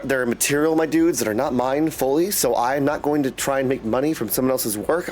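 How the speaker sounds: normal talking voice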